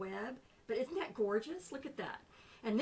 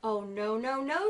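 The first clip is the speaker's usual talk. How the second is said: louder, alarmed little fly; fly's refusal; 7 dB louder than usual. Acted. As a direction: refuse the spider firmly.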